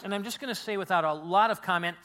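usual speaking voice

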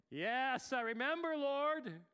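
high, loud cry of yes; loud admission. Acted. Pastor answers God, loud high admission.

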